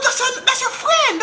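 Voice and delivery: very high mock mother voice; put-on high-pitched maternal protest; imitated exasperated mama. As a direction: insist in a piping mom voice. Mama answering, acted out.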